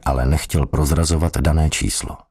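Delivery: ordinary delivery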